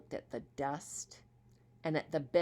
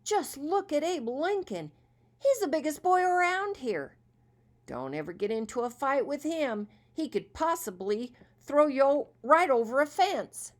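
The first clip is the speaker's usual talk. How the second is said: put-on high, louder excited kid voices; awed boys; 9 semitones higher, 8 dB louder than usual. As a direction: brag about the big boy in awe. Boys marvel at Abe.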